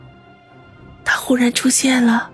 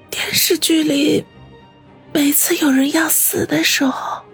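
weak, fading voice; dying words growing fainter; fading whisper. Acted. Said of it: "说话的声音越来越小".

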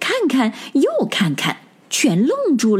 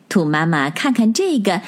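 ordinary delivery, nothing marked

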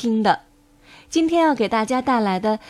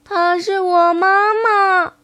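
high, slow, possessive little voice; baby turtle; 7 semitones higher, 4 dB louder, much slower than usual. Acted. Little turtle claiming its mother, high and drawn-out. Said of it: "小乌龟赶紧游到妈妈背上说".

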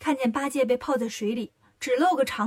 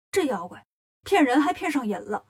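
ordinary delivery

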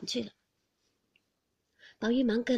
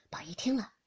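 ordinary delivery

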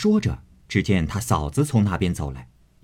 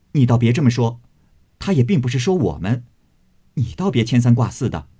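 fast, louder urgent protest; hasty protest; 4 semitones higher, 4 dB louder, faster than usual. Acted. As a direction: hurry to cut her off. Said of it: "忙道". (急忙抢白)

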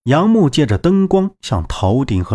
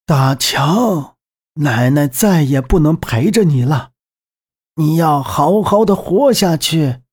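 put-on old woman voice; 4 semitones higher than usual. Grandma's farewell in fire.

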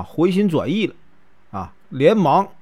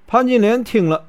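usual speaking voice

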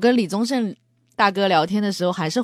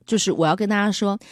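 ordinary talk

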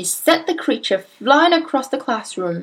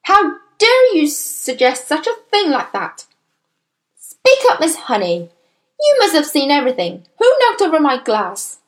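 high, outraged demands; affronted headmistress's raised voice; roaring; 5 semitones higher, 3 dB louder than usual. A narrator's reading. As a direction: angrily demand answers from a teacher. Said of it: "roared the Trunchbull".

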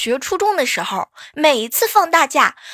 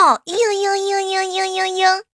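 put-on high, whiny girly whimpering; coquettish whimper; 7 semitones higher than usual. Shown as a demonstration. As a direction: play-act a cutesy sulking girl. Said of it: "就是那种捶你胸口".

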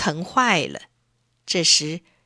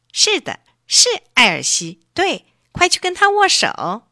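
eager lobster; 7 semitones higher, 3 dB louder than usual. A narrator's reading. Lobster eagerly urges handshake.

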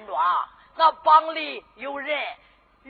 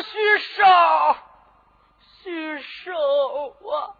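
tearful call; 3 semitones higher, 4 dB louder, slower than usual. Acted. Condemned man recognizes old servant.

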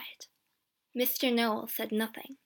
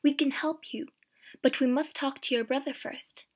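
ordinary delivery

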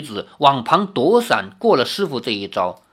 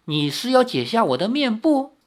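coy, higher-pitched query; lightly amused; 7 semitones higher than usual. A narrator's reading. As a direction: tease with a light air of amusement. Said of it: "轻笑着说".

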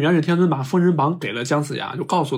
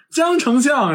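high, loud call from a distance; calling out from behind; 11 semitones higher, 4 dB louder, slower than usual. Retold or imitated. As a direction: call after him to make him turn around. Calling after Jiang Ziya. (高声远远地喊)

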